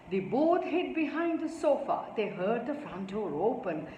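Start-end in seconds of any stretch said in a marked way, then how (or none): none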